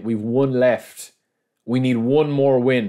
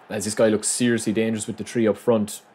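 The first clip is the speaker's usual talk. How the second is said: ordinary delivery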